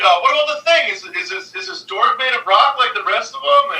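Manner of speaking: high, eager fanboy asking his idol; gushing fan. Retold or imitated. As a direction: imitate an excited fan with a pressing question. Acting the gushing fan's question.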